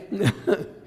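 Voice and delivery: high imitated giggle. Father imitating the son's helpless high giggle. Son laughing at unknown joke.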